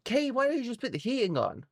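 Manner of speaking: quick, high mock viewer voice; a hint of a smug viewer asking; imitating a viewer. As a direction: needle her like a know-it-all viewer. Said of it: "I know what you're thinking".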